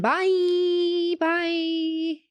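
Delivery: high, long-held farewell notes; drawn-out high sign-off; sing-song goodbye. Real, not acted. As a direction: stretch each bye out on a high pitch. Host signs off the show.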